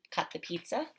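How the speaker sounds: ordinary talk, nothing marked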